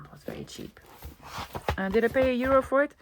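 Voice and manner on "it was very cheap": whispered aside. Real, not acted. Telling viewers the bargain price as a hushed secret whisper. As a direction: drop to a soft, breathy whisper for the repeat. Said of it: "and it was very cheap as well".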